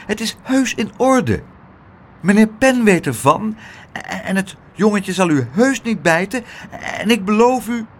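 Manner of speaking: put-on high, earnest young voice; anxious persuading; pleading reassurance. A narrator's reading. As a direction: plead hesitantly to be believed. Pluk pleads with the angry doorman.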